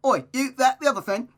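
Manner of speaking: high, loud mock-British blurting; hammed-up British accent, shouted; Gavin Free impression. Reader joking away the book's seriousness, mock-British accent. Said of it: "just completely change your accent".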